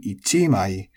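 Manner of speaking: ichimai peaking on chi, then falling; accent on CHI. Shown as a demonstration. Teacher demonstrating the counter, ichimai accented on chi. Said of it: "with the accent on the chi".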